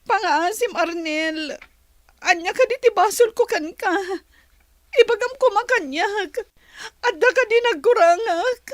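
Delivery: loud tearful begging; pleading. Acted. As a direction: demand to know her fault. Pleading, asking what she did wrong.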